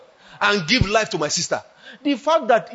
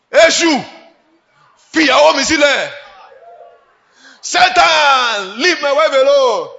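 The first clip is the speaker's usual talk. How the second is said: loud, higher commanding rebuke of Satan; 4 semitones higher, 6 dB louder than usual. Retold or imitated. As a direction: order Satan off his wife. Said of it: "Daddy just looked at her".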